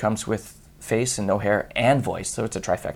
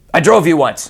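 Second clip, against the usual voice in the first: cab driver quote; 5 semitones higher, 8 dB louder than usual. Retold or imitated. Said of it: "he goes".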